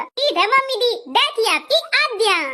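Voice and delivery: put-on high, childlike lisp. Bride obeying her mother-in-law, high lisp. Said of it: "लगता है बहू सच में तोतली है".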